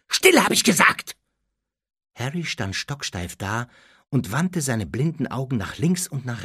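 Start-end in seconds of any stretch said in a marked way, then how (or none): 0.1-1.2 high, urgent snapped order; tense, impatient bark; sharp command. Acted. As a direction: cut him off sharply. Harry cuts off panicking Dudley.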